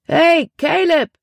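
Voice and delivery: high, slow, loud hail from far back; calling out. A narrator's reading. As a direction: wave and shout to a friend in the crowd. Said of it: "rief er".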